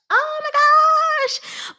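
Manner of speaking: very high, drawn-out squeal; fangirl squeal. Real, not acted. Reader gushing over the baby's first words, squealing. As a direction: stretch the gasp out in delight.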